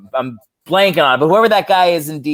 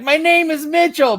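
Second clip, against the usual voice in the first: indignant insistence; 12 semitones higher than usual. Retold or imitated. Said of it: "Mitchell being like".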